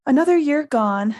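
loud, high, hearty announcement; cheerful. A narrator's reading. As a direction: open a speech brightly to the hall. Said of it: "Dumbledore said cheerfully".

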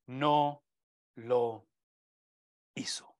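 slow, one word at a time, dropping away; dramatic pauses. Real, not acted. Preacher revealing the answer to the congregation, slow, spaced-out words. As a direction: let each word stand alone before the next.

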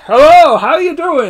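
loud, high stagey greeting; vaudeville voice. Retold or imitated. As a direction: put on a hammed-up vaudeville act. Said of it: "doing her vaudeville voices".

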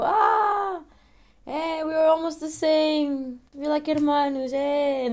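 high delighted Spanish voice; happy Spaniard. Retold or imitated. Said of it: "Ficam tipo".

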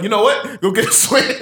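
angry mama. Retold or imitated. Angry mama voiced in story.

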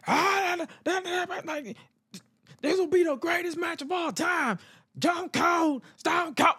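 high, shouted hype call; hammed-up high commentator yelling; imitating JR screaming. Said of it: "he was screaming on the t-, from the top of his lungs".

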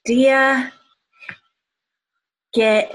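ordinary talk

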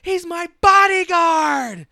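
high, loud, slow shout; drawn-out mock dramatic cry; hammed-up title line. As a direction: belt it out as the big dramatic title drop. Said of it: "them saying the title".

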